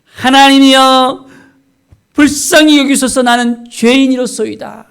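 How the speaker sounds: loud, high, drawn-out cry to God; breast-beating plea. Retold or imitated. Tax collector begging God for mercy, breast-beating plea. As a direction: implore mercy at full voice, stretching the words. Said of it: "가슴을 치면서".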